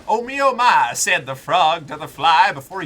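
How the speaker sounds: loud, high-pitched storyteller. Acted. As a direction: belt it like a tall tale. Loud quote with punchline.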